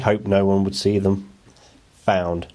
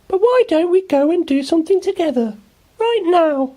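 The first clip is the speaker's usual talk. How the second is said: put-on very high, louder little girl voice, cheerful; a bright little girl introducing herself; Ruby smiling; 4 dB louder than usual. A narrator's reading. Ruby greeting Oliver, very high cheerful girl voice. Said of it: "said Ruby".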